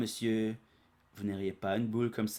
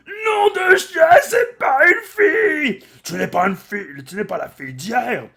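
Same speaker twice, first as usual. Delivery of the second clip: very high, loud, outraged yell; furious shout; 16 dB louder than usual. Retold or imitated. The monster realising the girl is a boy, yelling in outrage. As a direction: blow up at the deception.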